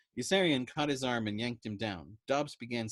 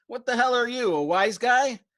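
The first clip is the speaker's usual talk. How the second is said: much higher, much louder yell; yelled back; 13 semitones higher, 13 dB louder than usual. A narrator's reading. Dobbs yelling back at the patient, much louder and higher. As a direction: yell back ready to fight. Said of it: "Dobbs yelled back".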